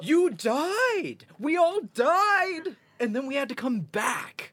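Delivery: gasping, high alarmed cry; panicked outburst. Acted. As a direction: blurt it out in shock. Gasp, then a panicked outburst.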